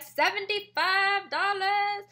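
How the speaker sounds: loud, high, drawn-out prize announcement; game-show style reveal, silly and over the top; really excited. Retold or imitated. Host raises the bingo prize for guests, hammed-up excitement. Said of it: "sorry, I'm really excited".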